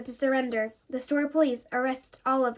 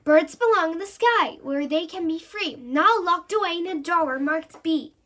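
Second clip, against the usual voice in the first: high, loud protest; yelling; 5 semitones higher, 5 dB louder than usual. Acted. Jill yelling at the monster to free birds. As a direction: shout to stop him hurting the bird. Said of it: "Jill jumps up and yells".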